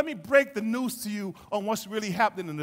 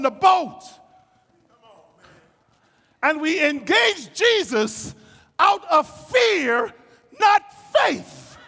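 high, loud, emphatic sermon delivery; full-force preaching; 10 semitones higher, 9 dB louder than usual. Acted. Preacher telling the congregation they acted from fear, shouting. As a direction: drive each phrase home with a shout.

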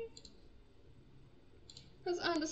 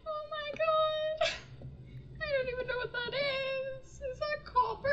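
stressed; 10 semitones higher than usual. Real, not acted. Panicked player can't find pickaxe, wailing high. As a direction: wail it out in real panic.

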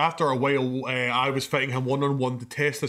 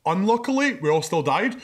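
ordinary talk, nothing marked

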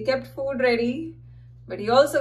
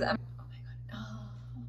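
whispering in pain; 14 dB quieter than usual. Real, not acted. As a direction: moan it under your breath.

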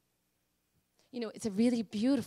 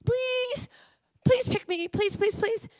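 high, loud plea; tearful begging; 13 semitones higher, 6 dB louder, slower than usual. Retold or imitated. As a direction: plead again to be picked. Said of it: "and I'm crying".

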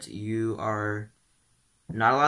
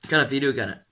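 ordinary delivery

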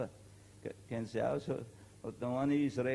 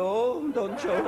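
high, slow words swallowed in laughter; laughing; 8 semitones higher, 7 dB louder, much slower than usual. Real, not acted. Teller finishing the joke for listeners, laughing hard. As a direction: burst out laughing at your own joke. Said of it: "laughing at own joke".

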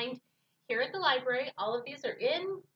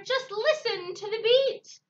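roaring; 8 semitones higher, 8 dB louder, slower than usual. Acted. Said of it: "roared the leader".

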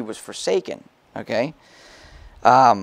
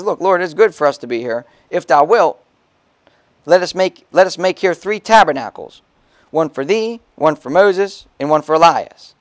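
raised pulpit reading of Peter's words; lively Bible reading; preaching scripture; 3 semitones higher, 4 dB louder than usual. Acted. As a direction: proclaim it, a bit louder. Reads Peter's words in church.

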